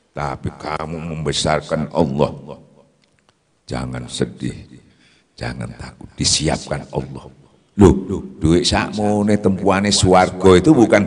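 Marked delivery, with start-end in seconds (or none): 3.7-7.3 tender reassurance. Acted. Preacher gently comforting listeners who fear loss. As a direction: ease their fears gently, much softer.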